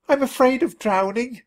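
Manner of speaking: high, trembling fearful plea; a mouse terrified of water; frightened squeak. A narrator's reading. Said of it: "I squeaked".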